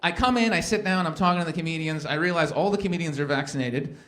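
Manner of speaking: ordinary talk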